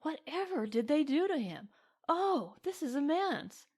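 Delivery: high, delighted woman's voice; Helen, delighted. A narrator's reading. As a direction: gush with amused delight. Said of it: "exclaimed Helen in delight".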